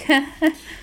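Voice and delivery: high brushing-off laugh; mock laugh; light dismissive laugh. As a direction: laugh it off like a joke. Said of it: "so I was just like".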